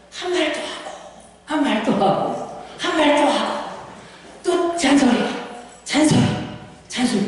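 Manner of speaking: lightly mimicked repetitive nag; mock nagging. Preacher showing what a kkondae does, mock nagging. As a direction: imitate someone going on and on.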